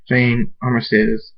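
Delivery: usual speaking voice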